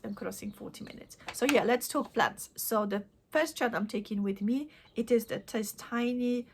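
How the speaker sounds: ordinary talk, nothing marked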